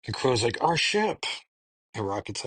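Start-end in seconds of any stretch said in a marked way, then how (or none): 0.6-1.2 high-pitched, hammed-up lament; imitating a dismayed outcry; mock dismay. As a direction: wail it lightly, pitched up high. Retold dismay at destroyed ship.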